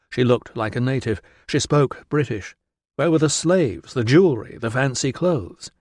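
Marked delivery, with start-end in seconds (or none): none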